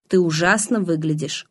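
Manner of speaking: usual speaking voice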